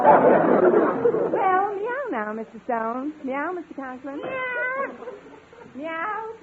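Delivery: Western drawl meow. Acted. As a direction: drawl each meow out long like a cowgirl. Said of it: "sometimes I stretch it out with a little Western drawl".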